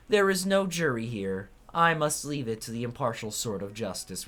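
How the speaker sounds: high, loud, theatrical verdict; grand proclamation. Acted. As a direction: declaim the ruling like a stage judge. Judge's long verdict speech.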